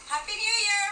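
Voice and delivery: excited. Real, not acted. New Year podcast greeting.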